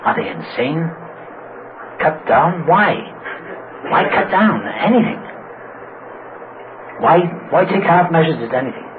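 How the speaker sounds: mock incredulous, rising on the questions; a touch of scornful bafflement; disgusted disbelief. Drinker scoffing at advice to cut down, incredulous. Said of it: "that disgusted look".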